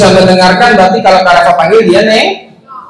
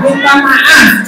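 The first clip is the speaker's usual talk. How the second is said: very slow, higher "Minta maaf"; corrective model; 5 semitones higher, much slower than usual. Shown as a demonstration. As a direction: correct with a long drawn-out "maaf". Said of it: "Masa minta mama?".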